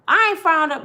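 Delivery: loud, high indignant denial; mock indignant protest; defensive denial. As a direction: snap back defensively. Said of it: "I'm like".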